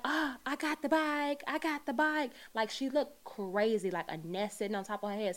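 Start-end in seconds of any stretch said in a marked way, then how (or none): 0.1-2.3 high, wild, crazed voice; Wanda impression. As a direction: shriek it like an unhinged woman. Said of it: "She looked like Wanda in the last scene".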